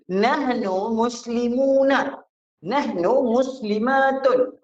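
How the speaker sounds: nahnu muslimuna said slowly and high, endings clear; teacher modelling Arabic plurals; slow classroom model of Arabic words; model pronunciation. Shown as a demonstration. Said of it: "apa yang ditambah kat belakang ni?".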